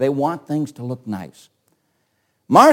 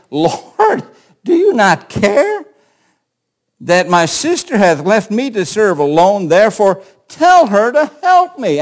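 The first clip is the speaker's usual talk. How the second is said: louder, higher, pleading complaint; exasperated; 6 semitones higher, 6 dB louder than usual. Shown as a demonstration. Martha, worn out from serving, complains to Jesus, exasperated and loud. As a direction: let the frustration climb to tell her to help me. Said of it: "perhaps she's exasperated".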